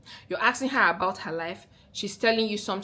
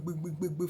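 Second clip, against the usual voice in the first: imitated bass rumble; 4 semitones lower, 6 dB quieter than usual. Vlogger to viewers, imitating a bass voice rumble. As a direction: mimic a deep voice trembling in the throat. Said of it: "you can just hear it trembling the".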